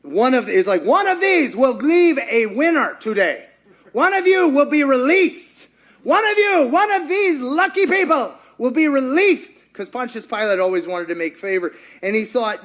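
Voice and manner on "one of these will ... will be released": loud, high game-show announcer hype; game show host. Retold or imitated. Pilate presenting the prisoners like a game-show host, loud and hyped. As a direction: sell it like a TV quiz host. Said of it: "kinda like Who Wants to Be a Millionaire".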